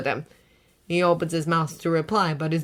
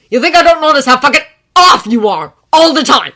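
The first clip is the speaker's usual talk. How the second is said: angry; 8 semitones higher, 14 dB louder than usual. A narrator's reading. Bakugo yelling at Izuku.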